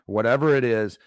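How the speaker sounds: ordinary talk, nothing marked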